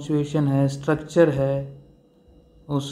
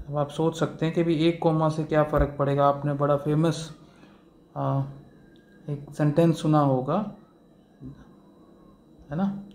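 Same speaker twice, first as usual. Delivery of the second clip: ordinary talk, nothing marked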